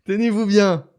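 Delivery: "Tenez-vous bien" lancé fort et haut, façon annonce dramatique; dramatic announcement. Acted. Dramatic build-up before the scoop. (« Tenez-vous bien » dit avec emphase théâtrale)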